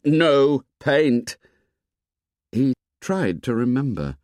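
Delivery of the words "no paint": a louder cry of refusal; boy chief shouting an order; shouted. A narrator's reading. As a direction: shout it down flat. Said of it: "Ralph shouted".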